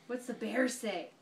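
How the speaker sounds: ordinary talk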